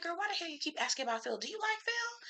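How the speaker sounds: teasing quote. Retold or imitated. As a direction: tease her quickly in a high voice. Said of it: "I'm like".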